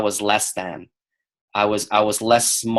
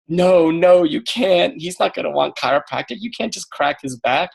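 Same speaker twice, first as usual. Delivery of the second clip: higher, anxious inner voice of doubt; a panicky little voice in my head; 7 semitones higher than usual. Retold or imitated. Inner voice of doubt, higher and anxious. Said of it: "every voice was like".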